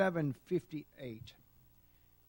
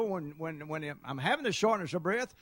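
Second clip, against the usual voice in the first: normal talking voice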